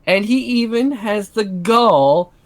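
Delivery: slow, high indignant outrage; outraged disbelief. Acted. [name] indignant rant.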